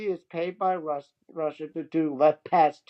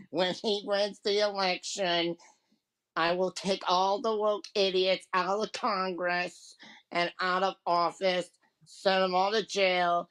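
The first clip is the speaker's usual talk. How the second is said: Miss Green. Acted. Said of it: "I had to do the Southern voice".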